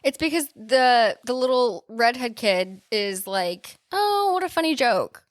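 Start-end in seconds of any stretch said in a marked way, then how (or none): 3.9-5.2 high-pitched imitation of an amused kid; mock kid voice. Co-host imitates the little redhead kid, mock kid voice. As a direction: pitch it up like a kid dismissing a prank.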